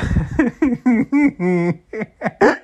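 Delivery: real high giggling fit; helpless laughter. Real, not acted. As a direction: burst into uncontrollable laughter. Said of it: "I'm fucking crying how funny it is".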